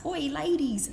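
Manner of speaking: a lighter retelling of the Cockney shout; light Cockney call. Retold or imitated. Retelling what men shout from cars, mild Cockney. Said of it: "It does involve an accent ... Cockney one".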